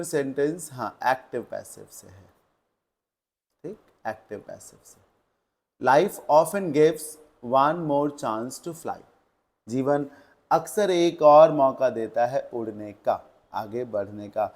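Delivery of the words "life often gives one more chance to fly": very slow, each word separate; slow dictation. Shown as a demonstration. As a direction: read the test sentence for the class. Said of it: "reading out exam sentence". (बहुत धीमे वाक्य पढ़ना)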